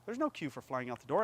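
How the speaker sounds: ordinary talk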